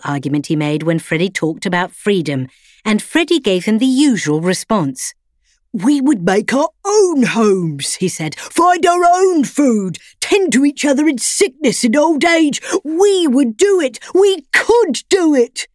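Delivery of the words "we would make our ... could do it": higher, louder put-on voice of a defiant dog; rebellious dog. A narrator's reading. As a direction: demand freedom with stubborn fire. Freddy character voice.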